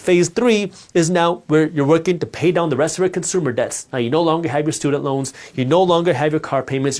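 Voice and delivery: ordinary talk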